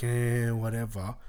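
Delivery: slow, bored "eh, whatever"; dismissive shrug. Retold or imitated. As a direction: brush it off lazily. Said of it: "everyone else kinda like".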